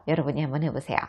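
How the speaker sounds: ordinary talk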